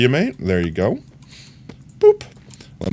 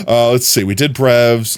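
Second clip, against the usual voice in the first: ordinary delivery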